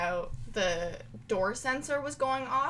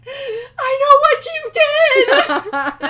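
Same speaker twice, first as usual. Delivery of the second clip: high, slow, shrieked accusation; screaming movie villain; 15 dB louder, much slower than usual. Retold or imitated. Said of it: "goes throughout the whole movie being like".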